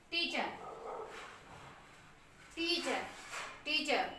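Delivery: टीचर with the final r dropped, ending on a soft uh; टीचर without the r; r silent in teacher. Shown as a demonstration. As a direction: say टीचर as TEE-chuh, no r at the end. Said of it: "आर silent में जाएगा टीचर".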